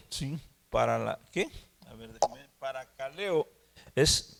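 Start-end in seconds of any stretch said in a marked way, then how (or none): 2.6-3.5 paracaleo said softly, feeling it out; trying out a word. Real, not acted. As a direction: quietly sound out the word to oneself. Checking the Greek word before announcing it.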